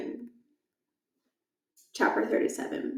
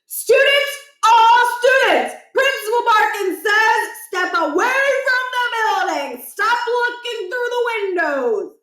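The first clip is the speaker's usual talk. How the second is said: high, loud official command; principal's bellow; 13 semitones higher, 8 dB louder, slower than usual. Acted. Principal ordering all students away from the building, loud. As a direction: boom it over a crowd.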